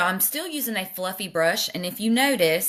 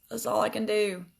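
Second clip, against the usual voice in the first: normal talking voice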